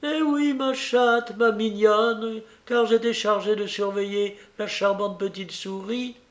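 put-on high-pitched, doting old woman; confessing old lady. A narrator's reading. Madame Dor confesses to the bride, high-voiced and doting.

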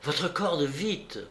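brief, urgent order; curt demand. A narrator's reading. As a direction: ask briskly, with no softening. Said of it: "lui demanda d'une voix brève". (voix brève et pressante)

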